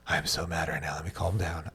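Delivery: low, near-whispered self-talk; muttering to self. Retold or imitated. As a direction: mutter it very softly under your breath. Acting out calming himself before punishing.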